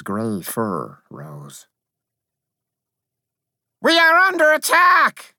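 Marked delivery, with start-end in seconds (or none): 3.8-5.3 very high, loud little-creature cry; high-pitched battle alert; alarmed cry. A narrator's reading. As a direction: sound the alarm in a piping voice. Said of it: "I cried".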